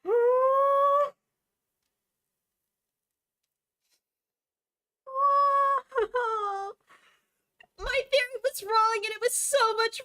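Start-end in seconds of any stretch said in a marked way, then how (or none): 0.1-6.7 high, choked crying words; real sobbing. Real, not acted. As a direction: let the tears break through every word. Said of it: "I did not expect to start sobbing".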